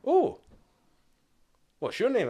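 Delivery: officer greeting the recruit in a high plummy voice; posh officer. Retold or imitated. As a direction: play a plummy officer, amused.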